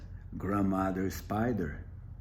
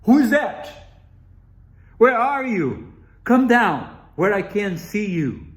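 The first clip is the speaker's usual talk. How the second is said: much higher, louder searching call; 9 semitones higher, 11 dB louder than usual. Acted. Wolf calling to the hidden voice, searching loudly. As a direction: call out to someone you cannot see.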